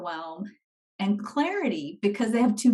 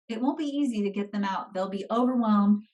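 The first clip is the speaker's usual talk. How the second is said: normal talking voice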